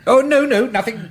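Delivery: high, loud flustered denial; flustered polite protest; hasty reassurance. Acted. Hastily reassuring the confused lecturer.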